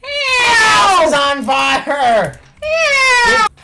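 high, slow hammed-up cry for help; mock damsel cry. Gamer voices the game's captive to Leon, hammed-up screams for help. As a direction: wail like a damsel in distress.

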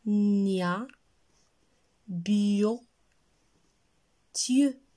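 "nia, bio, Dieu" loud, one at a time with pauses; syllable drill. Shown as a demonstration. Teacher reviews syllables with children, each sounded out loudly. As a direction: stretch "nia" and "bio" as nnn-ya, bii-yo, then a short tyeu for "Dieu". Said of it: "Alors on répète".

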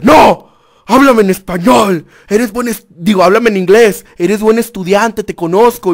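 loud, high-pitched mock command; imitated stern director. Director demands the student speak English, imitated loud insistence. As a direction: order it emphatically as a scolding school director.

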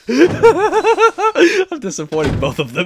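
high, slow, laughing through words. Real, not acted. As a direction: giggle it out. Laughing at his own play.

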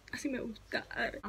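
a soft, breathless whisper. Real, not acted. Whispering to the camera once it focuses, pleased whisper.

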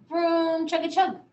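loud, high playful vroom and chug; reading to a child, loud playful engine noises. A narrator's reading. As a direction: make the car sound big and fun. Boy's car rumbling along.

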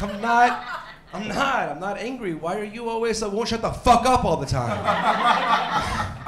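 a hammed-up angry outburst that dissolves into laughter; angry denial. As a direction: bristle and insist you're not angry. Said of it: "And I'm like".

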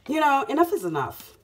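loud, punchy, fed-up "enough is enough"; forceful declaration. Real, not acted. Tarot reader telling viewers she's had enough, loud and fed up. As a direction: slam down "enough is enough" like a final word.

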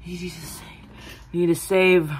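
breathless whispered plea; faint whispered plea; weak whisper. Acted. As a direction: gasp it out before collapsing. Day, exhausted, passing out.

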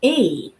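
E said as its name; E held as a clear long ee; long E sound. Shown as a demonstration. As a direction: say the letter name E. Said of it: "the long E sound says".

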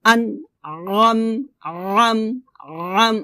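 slow tajweed with pressed ain; measured teaching recitation; repeat recitation. Shown as a demonstration. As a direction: recite drawn-out, squeezing the throat on ain. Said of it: "আমি আবার বলি".

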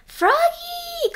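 high, loud drawn-out call of Froggy!. A narrator's reading. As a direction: call across the restaurant, stretched. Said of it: "called his father".

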